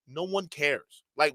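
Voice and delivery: normal talking voice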